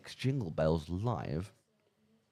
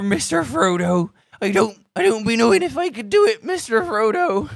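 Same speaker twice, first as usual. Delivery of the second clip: much higher, loud West Country pirate voice; Samwise as a pirate; 9 dB louder than usual. Pretend. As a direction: Samwise pleading with Frodo in pirate talk. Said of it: "Samwise trying to be a pirate".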